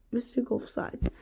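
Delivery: plain reading voice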